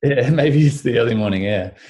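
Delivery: a real chuckle mid-sentence; laughing. Real, not acted. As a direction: laugh it off, amused. Laughs at croaky voice joke.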